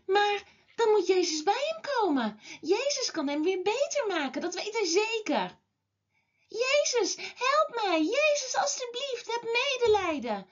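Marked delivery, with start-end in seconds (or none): 6.5-10.5 high-pitched pleading cry, little added volume; mock shouting. A narrator's reading. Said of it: "begint Bartimeus heel hard te schreeuwen". (hoog, smekend geroep, ingehouden geschreeuw)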